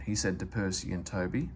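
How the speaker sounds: plain reading voice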